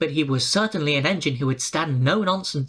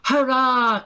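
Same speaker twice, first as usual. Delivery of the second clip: higher, louder cheer; 7 semitones higher, 4 dB louder than usual. A narrator's reading. Peter Sam catching Henry, cheering. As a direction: burst out with relief. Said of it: "cried Peter Sam".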